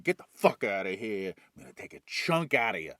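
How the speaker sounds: low, gruff monster growl; a grumpy monster woken from a nap; gruff space worm. Acted. Space worm snarling at the intruders, low and gruff. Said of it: "the dildo with teeth's like".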